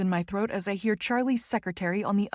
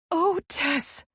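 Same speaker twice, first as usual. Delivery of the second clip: high, falling exclamation; crying out; 9 semitones higher than usual. A narrator's reading. Friend finally reaching Tess, crying out. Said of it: "she cries".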